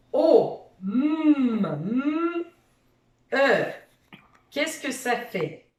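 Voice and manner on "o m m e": letters sung high, one by one; sounding out letters. Shown as a demonstration. Teacher sings the letters of pomme for the child. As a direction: sing each letter sound of omme. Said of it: "Fais chanter tes alphas".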